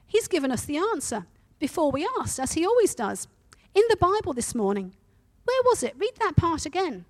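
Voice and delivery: bubbling with discovery; excitedly. Acted. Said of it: "Betsy said excitedly".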